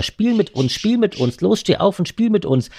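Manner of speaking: put-on high, playful children's voice; childlike voice. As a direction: call out like little children. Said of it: "wie kleine Kinder".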